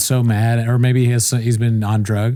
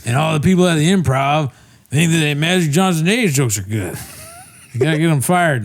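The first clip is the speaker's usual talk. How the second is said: put-on higher-pitched, drunken Southern senator; drunk Southern accent; 4 semitones higher than usual. Comic playing McCarthy railing at the club, drunk Southern accent. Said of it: "I have a Southern accent in this".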